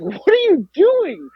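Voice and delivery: high, slow, drawn-out mock disbelief; astonished question, acted out slowly; bewildered dad, imitated. Dad waking her, retold.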